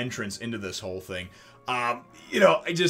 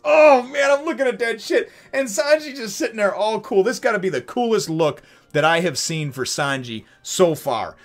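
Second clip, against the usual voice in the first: usual speaking voice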